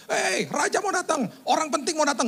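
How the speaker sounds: high, stretched-out town crier call; herald's cry. Retold or imitated. Forerunner announcing the king to the city, high calling-out. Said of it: "lari ke dalam kota teriak".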